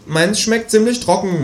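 high, slow whiny complaint; grumbling kangaroo. A narrator's reading. Kangaroo complaining about its food, grumbling. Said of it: "mault das Känguru".

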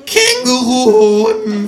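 high, loud, slow kangaroo outcry; startled outcry. A narrator's reading. Kangaroo startled out of its trance, loud and high. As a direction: jolt awake on the word. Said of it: "fährt das Känguru aus seiner Trance empor".

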